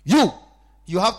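loud, slow, high preaching cry; loud pulpit cry, drawn out; preaching shout. Acted. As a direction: proclaim it at full force. Preaching at full force.

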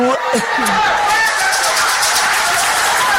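high unvoiced laughter; breathy delighted laugh. Real, not acted. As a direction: laugh freely at the story. Laughing about auntie's reply.

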